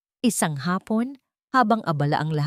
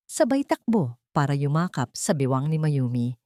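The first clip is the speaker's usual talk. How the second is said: plain reading voice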